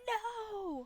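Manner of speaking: high, despairing "No!"; anguished cry. Retold or imitated. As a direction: cry out like the play just failed. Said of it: "I can't imagine Houston going".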